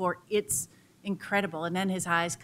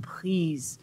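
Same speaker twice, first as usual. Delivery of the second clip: breathy whispered please; pleading whisper from a desperate coyote. Acted. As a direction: beg in a hushed whisper. Coyote begs the bird again.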